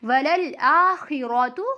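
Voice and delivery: slow "वलल आखिरतु", long आ and rounded र; thick R recitation. Shown as a demonstration. Teacher models the verse, "आखिरतु" drawn out with heavy र. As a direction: recite "आखिरतु" slowly with a full र. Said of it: "रॉ को पुर".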